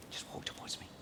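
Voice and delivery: soft whispered instruction. Real, not acted. Host quietly coaxing a droid walker forward, whispered. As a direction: whisper it to the performer. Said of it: "whispered instruction".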